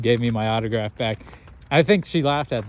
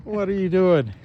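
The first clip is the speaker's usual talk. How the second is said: mock scolding himself; 6 semitones higher than usual. Replaying the recording, scolding himself in higher exasperated tones. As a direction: scold yourself in disbelief, pitched up. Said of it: "I was definitely pissed at myself".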